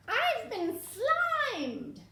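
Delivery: very high slow wail; dismayed witch. Acted. Witch complaining she got slimed. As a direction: wail in disgust.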